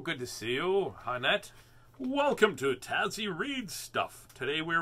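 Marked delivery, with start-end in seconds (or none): none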